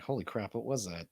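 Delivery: ordinary talk, nothing marked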